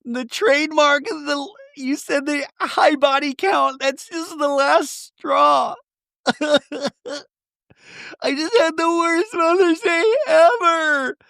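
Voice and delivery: high, drawn-out wailing imitation; mock bawling. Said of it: "Brittany bawling".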